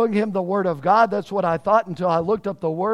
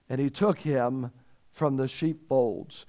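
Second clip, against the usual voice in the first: lower, softer, measured close of the verse; solemn scripture reading; 5 semitones lower, 5 dB quieter than usual. Real, not acted. Preacher finishing the Psalm for the church, low and solemn. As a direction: drop low and quiet, slowing to the end.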